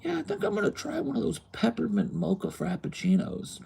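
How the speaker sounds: high, airy customer voice. Retold or imitated. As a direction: order like a dithering customer. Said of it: "the customer would be like".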